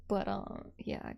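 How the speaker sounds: ordinary talk, nothing marked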